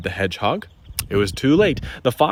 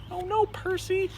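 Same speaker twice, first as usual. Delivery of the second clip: high dismayed oh no; dismayed exclaim. Acted. Reader dismayed at Percy eating the treasure. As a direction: cry out at the silly mistake.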